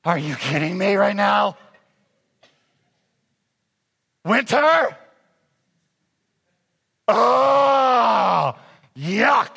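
mock disgust. Preacher imitates waking up to winter snow, mock disgust. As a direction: sulk at the snow outside. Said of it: "looked at this outside and went".

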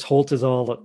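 ordinary talk, nothing marked

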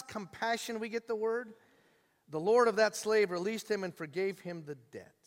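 ordinary talk, nothing marked

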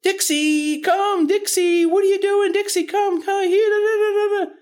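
very high, excited calls to the puppy; overexcited baby-talk to the dog; squeaky sing-song puppy calling; high-pitched calling. Shown as a demonstration. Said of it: "Nothing like this".

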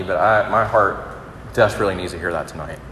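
normal talking voice

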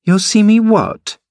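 put-on high, incredulous girlish question; surprised. A narrator's reading. Catherine answering the lad's curse, astonished. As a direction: squeak it out in astonishment. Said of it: "asked Catherine in surprise".

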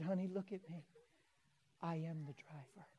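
soft, measured reveal; softly confiding. Retold or imitated. Preacher retells revealing himself to grandma, softly confiding. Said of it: "I said like this".

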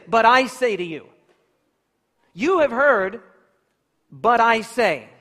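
declared loudly, with full authority; bold proclamation. Acted. Preacher voicing Jesus to the crowds, loud and commanding. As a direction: proclaim it like a prophet overruling tradition.

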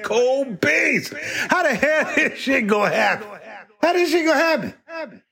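mock despair. Crew despairing over impossible numbers.

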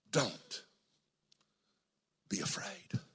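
soft whispered comfort; tender whispered reassurance; hushed whisper. Acted. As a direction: breathe it gently. Jesus' tender words whispered.

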